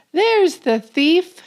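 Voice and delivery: high, loud cry of there he is; excited discovery. Acted. The reader spots the thief, high and loud. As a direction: point him out with glee.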